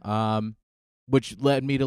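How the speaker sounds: usual speaking voice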